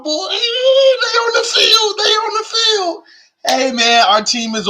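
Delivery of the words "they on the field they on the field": very high, drawn-out gleeful singsong; overjoyed high-pitched fan cheer; excited squeal. Real, not acted. Thrilled team is back at camp.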